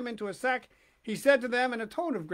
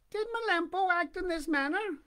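put-on high, squeaky wooden-boy voice; high puppet voice; 12 semitones higher than usual. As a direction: pipe it up like a puppet. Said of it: "asked Pinocchio".

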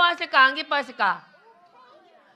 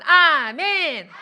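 loud, drawn-out group amen; rallying amen; 7 dB louder, much slower than usual. Real, not acted. Leads children in a group amen.

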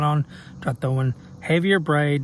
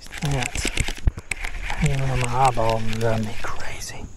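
hushed, breathy aside; whispering; 3 semitones lower than usual. Real, not acted. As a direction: whisper it under your breath. Gnats in his eyes, filming.

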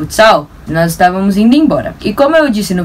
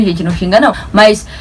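ordinary talk

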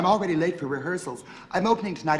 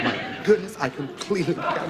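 ordinary talk, nothing marked

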